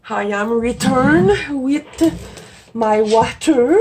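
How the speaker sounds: slow, drawn-out, playfully bad English accent; mock English accent. Vlogger back with her water, mock English accent. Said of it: "J'ai un bel accent anglais".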